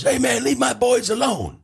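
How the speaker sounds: high mock coach warning; lightly voiced annoyed coach; imitating coach. Coach telling the heckler to leave his players alone, lightly imitated. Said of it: "He goes".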